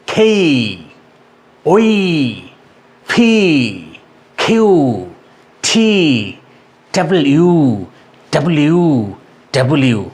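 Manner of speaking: "এই, যেই, খেই" with drawn-out glides; British or American style. Shown as a demonstration. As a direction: puff air on "খেই, ফি, খিউ, ঠি". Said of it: "British স্টাইলে".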